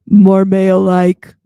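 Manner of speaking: slow, booming put-on baritone; very loud, mannish parody voice; mock male voice. Shown as a demonstration. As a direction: boom it out slowly like a man. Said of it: "sound more".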